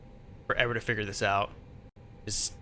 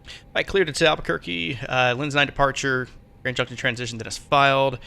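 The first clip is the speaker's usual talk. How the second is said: brisk, louder radio readback; pilot radio readback; 4 dB louder than usual. Real, not acted. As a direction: read it back like a pilot on frequency.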